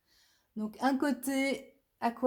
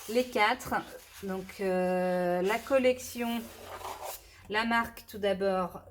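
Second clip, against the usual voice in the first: usual speaking voice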